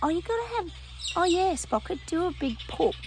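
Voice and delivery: high, sing-song voice for the dog; pet talk. Real, not acted. Talking to the dog Sprocket.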